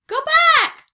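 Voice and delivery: very high, loud parrot screech; squawking parrot. A narrator's reading. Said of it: "she squawked".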